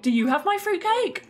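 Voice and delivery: a hammed-up whimper over cake; mock wailing. Player pretending to cry at Johnny over missing fruitcake, mock wailing.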